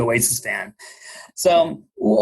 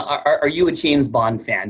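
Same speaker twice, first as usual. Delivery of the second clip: ordinary talk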